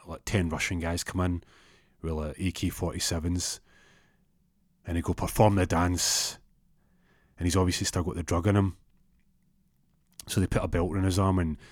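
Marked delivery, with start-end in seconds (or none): 5.1-6.4 menacing order. Retold or imitated. Armed Russians order.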